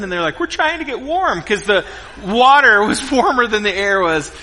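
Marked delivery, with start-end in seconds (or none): 0.4-1.4 lightly imitated kids, higher pitch; mild kid voice, pitched up; kids answering. Kids explaining to dad why they're in the river, mildly imitated higher voice.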